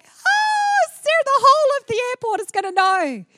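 an overjoyed mother greeting her daughter; excited shout. Pretend. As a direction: yell it so the whole airport hears. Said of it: "it'll be like".

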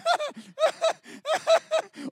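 very high, squeaky nervous giggle; imitating his own high-pitched giggle. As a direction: giggle like a starstruck kid. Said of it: "this weird sort of giggle".

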